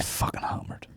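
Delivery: hushed whispered emphasis; whispering. Acted. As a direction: breathe it as a whisper. Whispered repeat of hammered.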